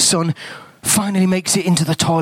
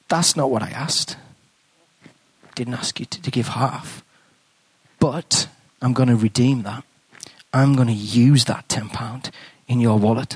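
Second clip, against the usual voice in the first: voice of God; 6 semitones lower than usual. Retold or imitated. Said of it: "I thought I heard God say".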